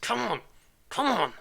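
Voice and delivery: high, strained gnome cheering itself on; squeaky little gnome urging the machine on; straining gnome. Retold or imitated. Gnome in the PC straining, high and eager. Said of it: "some gnome in the middle of your machine just cranking".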